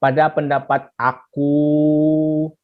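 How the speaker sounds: slow, drawn-out student phrase "Pada pendapat aku"; mock a halting student's opinion; wrong example. Shown as a demonstration. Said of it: "eh, tak ada begitu".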